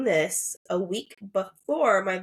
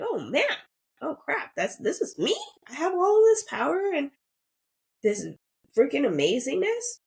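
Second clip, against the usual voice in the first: amazed realization; 8 semitones higher than usual. Retold or imitated. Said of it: "you come to terms like".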